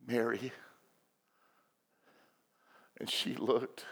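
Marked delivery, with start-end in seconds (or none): 0.1-0.5 gentle call. Retold or imitated. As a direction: say the name softly and tenderly. Jesus gently calls the weeping woman.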